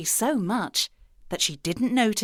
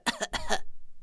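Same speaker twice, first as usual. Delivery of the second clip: wolf's put-on little cough; fake cough; 5 dB quieter than usual. A narrator's reading. Wolf pretending to have a cold, soft fake cough. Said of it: "I have a bit of a cold".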